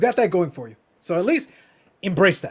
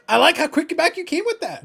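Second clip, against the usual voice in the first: normal talking voice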